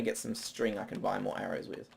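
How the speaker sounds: usual speaking voice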